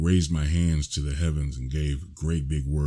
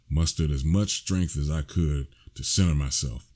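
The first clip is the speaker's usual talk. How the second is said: ordinary delivery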